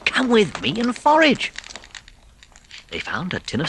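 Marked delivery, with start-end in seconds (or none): 0.1-1.5 higher, lively Rat voice; Rat's invitation. A narrator's reading. Rat urging Mole to forage, lively. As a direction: draw him along to the cupboard. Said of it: "said Rat".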